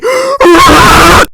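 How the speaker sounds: loud, high real laugh; high burst of laughter. Real, not acted. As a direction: crack up at your own joke. Laughs at own bit.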